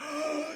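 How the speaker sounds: sharp acted gasp; shocked gasp. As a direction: gasp in mock horror. Doctor shocked by his aggression.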